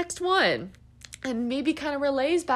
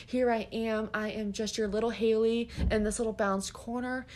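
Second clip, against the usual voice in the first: ordinary talk, nothing marked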